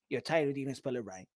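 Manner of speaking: low, soft mock complaint; quiet mocking nitpick; petty critic. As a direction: whine it like a nitpicker.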